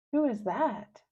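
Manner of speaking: ordinary delivery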